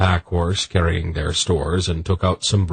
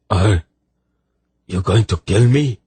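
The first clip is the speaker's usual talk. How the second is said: raised, louder anxious question; frightened; 3 semitones higher, 4 dB louder than usual. A narrator's reading. Soldier asking Dernek if he will kill him, frightened. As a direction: ask it fearing the answer. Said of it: "with an expression of fright".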